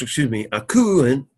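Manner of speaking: ordinary talk, nothing marked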